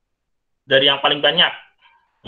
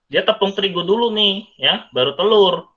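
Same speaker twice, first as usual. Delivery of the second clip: wrong order, marked; 8 semitones higher, 5 dB louder than usual. Shown as a demonstration. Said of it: "Salah ya".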